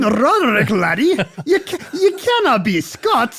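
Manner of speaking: put-on a jokey Scots burr breaking into laughter, Scottish accent. Teasing his cohost as a Scots clansman, laughing Scottish accent. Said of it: "your Scottish accent".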